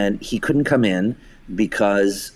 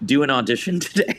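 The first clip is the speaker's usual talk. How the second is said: higher, half-laughing disbelief; amused disbelief; 6 semitones higher, faster than usual. Retold or imitated. Director asking the injured actor, in amused disbelief. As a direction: ask it in laughing amazement. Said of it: "And I said".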